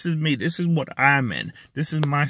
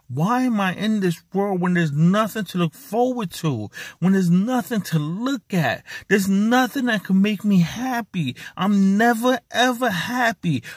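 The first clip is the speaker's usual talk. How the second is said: anguished, pitch climbing higher and higher; real despair; 5 semitones higher than usual. Real, not acted. Man with depression asking why he is here, in real despair.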